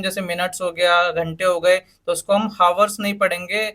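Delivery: ordinary talk, nothing marked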